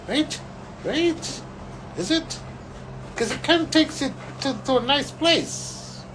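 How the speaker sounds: high playful questioning; lightly voiced Shiva, coaxing; teasing old man. Shiva teasing Eric about change, high playful voice.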